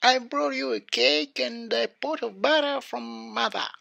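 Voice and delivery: high put-on girl voice; disguised wolf voice. A narrator's reading. Wolf pretends to be the grandchild, high disguised voice. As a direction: pass yourself off as a little girl, pitched high. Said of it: "changing his voice".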